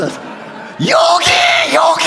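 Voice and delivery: high, drawn-out call to the room. Real, not acted. Pastor calls someone over, drawn-out high shout. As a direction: stretch it out, calling across the pews.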